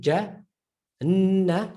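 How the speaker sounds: Jannah broken apart before a long N; split reading. Shown as a demonstration. Teacher showing how not to read it, split syllables. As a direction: pause inside Jannah, then hold N. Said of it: "you can't read".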